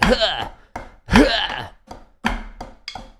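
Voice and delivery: two loud, high mock grunts; strained effort grunts. Shown as a demonstration. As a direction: grunt as if forcing each hit. Demonstrates tense, forced playing.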